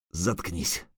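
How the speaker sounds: low, barely voiced dismissal; hushed retort. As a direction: cut them off under the breath. Bitter retort while hanging helpless.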